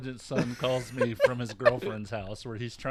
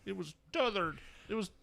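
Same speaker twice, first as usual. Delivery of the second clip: mock son; 9 semitones higher than usual. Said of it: "teasing son's voice".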